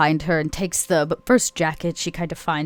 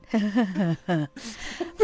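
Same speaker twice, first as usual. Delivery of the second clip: a would-be dainty giggle that comes out as a grunt; giggle turning into a grunt; 3 semitones higher than usual. Acted. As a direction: try to giggle sweetly and grunt instead. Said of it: "tries to let out a quaint giggle, but it comes out, I think, more as a grunt".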